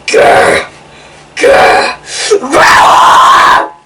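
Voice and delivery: loud rasping monster growl. Pretend. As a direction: growl like a hidden beast. Scary growl in the forest.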